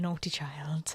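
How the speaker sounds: amused whispered aside. Real, not acted. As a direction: whisper it like a secret joke.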